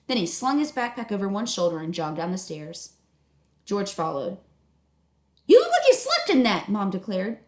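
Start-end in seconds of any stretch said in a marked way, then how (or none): 5.5-6.7 higher, louder mom calling; a busy mom calling up; brisk mom voice, pitched up; mom's voice. Acted. Mom scolds son.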